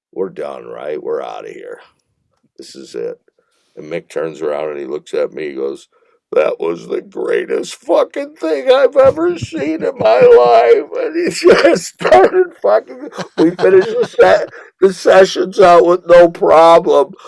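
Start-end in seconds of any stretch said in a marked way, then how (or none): 0.1-3.2 low, quiet aside to the producer; retold resignation. Retold dejected words to producer. 6.3-10.9 high-pitched, hammed-up raving; retold ecstatic outburst. 11.0-17.1 real laughter through high, loud words; laughing while talking. Real, not acted. Cracking up while telling story.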